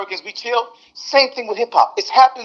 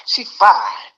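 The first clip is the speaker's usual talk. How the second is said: normal talking voice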